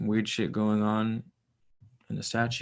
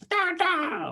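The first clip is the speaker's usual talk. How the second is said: put-on very high little-kid babble; toddler demanding a story. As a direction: mimic a small child's squeal. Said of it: "throws his back against my chest and goes".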